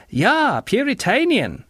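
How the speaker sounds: high-pitched taunt; high girlish sneer; jeering girl. A narrator's reading. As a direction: jeer it like a scornful girl. Girl jeers at John.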